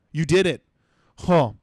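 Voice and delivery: normal talking voice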